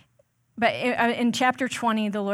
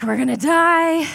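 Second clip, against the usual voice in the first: mock panic; 7 semitones higher, 8 dB louder, slower than usual. Israelites panic trapped at sea.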